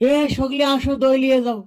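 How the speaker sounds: calling out. Acted. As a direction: call out to the crowd. Vendor calling out to crowd.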